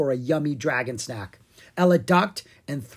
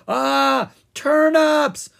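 much higher, loud panicked shriek; terrified scream; 13 semitones higher, 8 dB louder, much slower than usual. A narrator's reading. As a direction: scream in fright at the turnip. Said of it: "Owen screamed".

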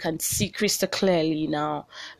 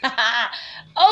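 bright laugh; 5 semitones higher, 5 dB louder than usual. Real, not acted. Laughs at her own rant.

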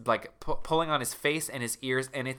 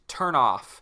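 ordinary talk

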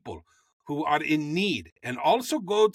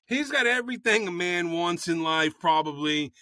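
ordinary talk

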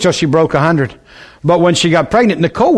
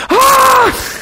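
labor scream. Retold or imitated. Preacher acting out a woman going through labor, screaming. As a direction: cry out as if in labor.

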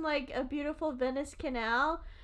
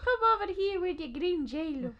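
higher, hammed-up Italian accent; 6 semitones higher than usual. Italian calling over the Jell-O carrier, Italian accent. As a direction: beckon in a mock Italian accent. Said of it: "an Italian accent's the only accent".

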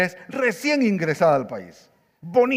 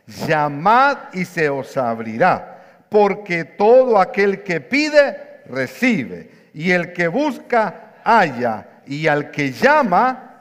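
slow, measured reading that swells louder at the end; solemn scripture reading; 5 dB louder, much slower than usual. Acted. Pastor leading the church in reading Matthew, slow and solemn. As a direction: read each verse slowly for the church to follow.

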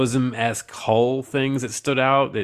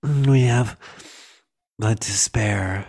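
sultry villain voice; slower than usual. Retold or imitated. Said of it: "I'm looking right at you like".

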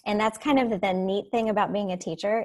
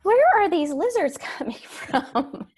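higher, louder, slow and laughing disbelief; surprised; 8 semitones higher, 5 dB louder, slower than usual. Retold or imitated. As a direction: ask it baffled, laughing at the mess. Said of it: "I'm like".

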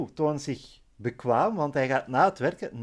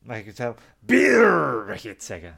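pirate voice; 2 semitones lower, 6 dB louder than usual. Pretend. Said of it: "met een piraatstem".

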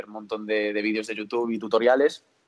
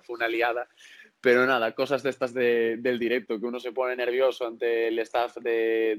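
ordinary talk

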